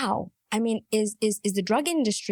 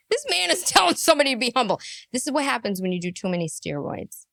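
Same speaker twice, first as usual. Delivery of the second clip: ordinary talk, nothing marked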